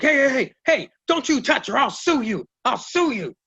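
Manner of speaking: high, frantic lawyer voice; high-pitched mock panic; panicky lawyer. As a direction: squeal the threat like a nervous lawyer. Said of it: "the lawyer's like".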